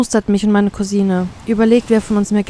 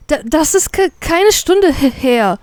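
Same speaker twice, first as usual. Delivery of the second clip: high-pitched, stuttering outrage; stunned growl; 7 semitones higher, slower than usual. A narrator's reading. Snapping at the captain in disbelief, angry. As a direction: protest in disbelief, tripping over words. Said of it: "knurre ich ihn an, fassungslos".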